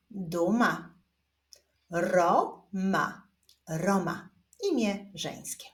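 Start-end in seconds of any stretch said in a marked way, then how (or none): 1.9-3.3 stretched first syllable, pause, then second; drawn-out, divided syllables; syllable reading. Shown as a demonstration. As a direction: read it slowly as two separate syllables. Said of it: "zapraszam Was do odczytywania sylab".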